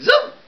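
tiny very high zip noise; zap sound effect. Acted. Space ranger zaps the villain with a sharp high zip. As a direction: squeak out a ray-gun zip.

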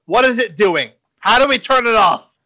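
loud, high, urgent shout; acted yell. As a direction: yell it at a henchman in a panic. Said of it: "He yells at Curtis".

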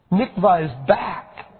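high, slow shouted announcement; joyful shout. A narrator's reading. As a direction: shout the news to everyone. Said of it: "overflowed and clapped".

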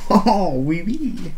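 drawn-out, swooping mock French; hammed-up French accent. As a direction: put on a playful French lilt, stretching the words.

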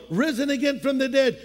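ordinary talk, nothing marked

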